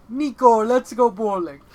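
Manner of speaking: put-on high, loud, cheery accented cousin; GTA cousin impression. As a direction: shout it like the game's pushy cousin. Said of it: "That was a G T A reference".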